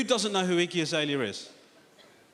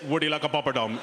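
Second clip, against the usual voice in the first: mock Indian accent; 2 semitones lower than usual. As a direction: put on a hammed-up Indian accent. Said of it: "for a little bit of authenticity".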